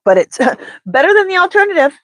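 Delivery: high laughing aside. Real, not acted. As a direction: laugh through the words. Laugh heard.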